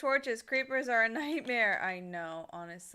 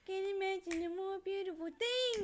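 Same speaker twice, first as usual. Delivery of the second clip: dreamy character voice; 9 semitones higher than usual. Retold or imitated. Quoting favorite line in voice.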